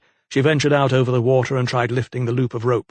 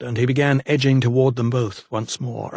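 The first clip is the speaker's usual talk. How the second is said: usual speaking voice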